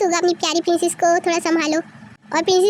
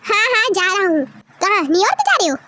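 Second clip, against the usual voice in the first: normal talking voice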